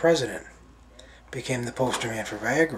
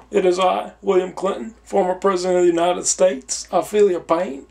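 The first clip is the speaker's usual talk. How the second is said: higher, louder put-on Southern politician voice; Clinton impression; 6 semitones higher, 5 dB louder than usual. Clinton presenting himself to Saint Peter in a put-on voice. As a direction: announce yourself like a grand ex-president. Clinton speaking at heaven's gate.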